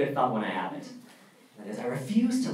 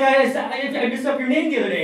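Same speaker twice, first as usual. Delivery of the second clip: flustered apology; 10 semitones higher, 6 dB louder than usual. Retold or imitated. Frantic apology to classmate.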